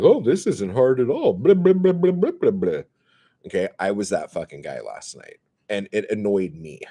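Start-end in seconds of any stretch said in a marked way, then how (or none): 0.0-2.8 high mocking singsong blah blah; mocking newbie. Mocking a smug first-time bettor, high singsong. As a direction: mimic the smug beginner. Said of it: "And they're like".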